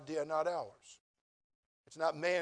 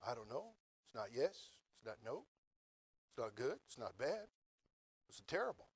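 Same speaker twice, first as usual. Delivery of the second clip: much softer, lower, musing to himself; inner thought; 5 semitones lower, 10 dB quieter than usual. Retold or imitated. Young man walking away, quietly puzzling to himself. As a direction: mutter the thought under your breath. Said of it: "and I thought".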